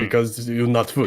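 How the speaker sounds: put-on wry contractor with a Slavic accent; Anton. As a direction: deliver the joke in Slavic-accented broken English. Anton finishes his joke.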